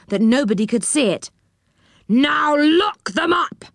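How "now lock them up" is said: high, slow, imperious order; commanding. A narrator's reading. Enchanter orders the prisoners locked away, commanding. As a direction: stretch it out as a command. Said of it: "commanded the enchanter".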